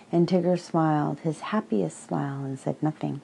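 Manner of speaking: plain reading voice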